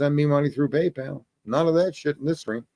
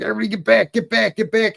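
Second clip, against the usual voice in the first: high, frantic mock yell to clear the crowd; crazy dude yelling; 9 semitones higher, faster than usual. Crazy dude warns the group away from the burning girl, high mock yell. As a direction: pitch it way up like a lunatic waving people off.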